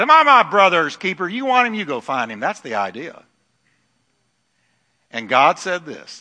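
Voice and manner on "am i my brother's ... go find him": lightly voiced defiant snap; a hint of a sassy Cain; sassy retort. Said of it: "He sassed him".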